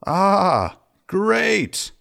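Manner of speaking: high, drawn-out mock-pleased gift acceptance; feigned delight. Accepting a tequila gift from brother's friends, feigned delight. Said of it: "here was my response".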